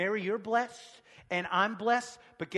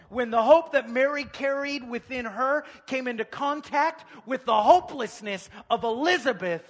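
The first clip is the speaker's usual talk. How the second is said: loud, high, building preacher's cry; full-force preaching; 7 semitones higher, 12 dB louder than usual. Real, not acted. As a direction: preach it out, punching the key words.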